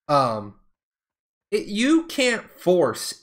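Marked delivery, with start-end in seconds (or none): none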